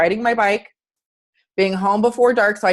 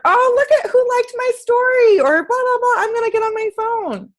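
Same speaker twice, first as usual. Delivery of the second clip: much higher, airy, giddy voice; mock distracted phone user; 14 semitones higher than usual. Coach mocking a distracted client, giddy much higher voice. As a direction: squeal it like a shallow social media fan.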